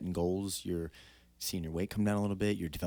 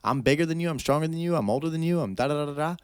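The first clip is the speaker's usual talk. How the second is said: high, louder mock taunting; taunting opponent; 8 semitones higher, 5 dB louder than usual. Host voicing the opponent trying to break the fighter, high mocking taunts. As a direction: taunt like a rival, piling up boasts in a raised pitch. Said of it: "that guy tried to bring it to you. He said".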